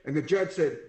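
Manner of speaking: normal talking voice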